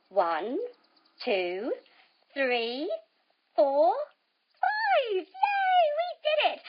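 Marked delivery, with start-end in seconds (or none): 4.6-6.6 cheering. Acted. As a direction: celebrate the count with the kids. Counting finish, cheering.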